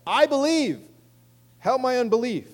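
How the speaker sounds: high, loud plea for help; crying out. Acted. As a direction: plead loudly as the desperate father. Acts the father's cry again.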